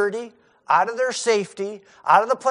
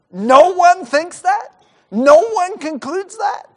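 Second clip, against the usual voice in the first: much higher, louder, slow incredulous question; incredulous disbelief; 11 semitones higher, 8 dB louder, slower than usual. Acted. Preacher marvels to congregation that disciples never think it, in incredulous disbelief. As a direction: draw it out as if you can't believe nobody thought of it.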